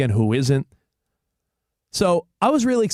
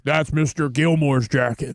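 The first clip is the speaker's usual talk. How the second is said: movie line impression; 2 semitones lower, slower than usual. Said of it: "that other big guy was like".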